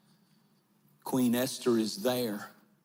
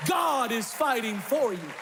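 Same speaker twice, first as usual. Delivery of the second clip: shouting the promise to the crowd; preaching at full force; 12 semitones higher than usual. Acted. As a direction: build it like a Sunday climax. Preaching climax over music.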